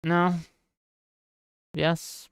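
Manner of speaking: ordinary talk, nothing marked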